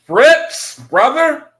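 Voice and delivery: high, loud delighted greeting; excited shout-out. Real, not acted. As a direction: hail a friend who just showed up. Calling out greeting to viewer.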